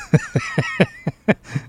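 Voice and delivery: helpless run of laughter; amused laugh. Real, not acted. Host reacting to a politician's accent clip, laughing. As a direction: laugh at what was just played.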